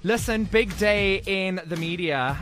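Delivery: ordinary delivery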